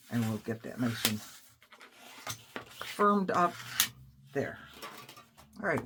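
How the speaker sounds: ordinary delivery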